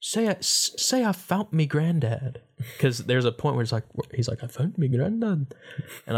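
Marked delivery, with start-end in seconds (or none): 0.0-2.4 put-on higher-pitched mom coaxing a child; imitating mom. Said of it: "my mom being like". 4.4-5.5 higher-pitched imitated Irish accent. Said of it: "I used to copy their accents".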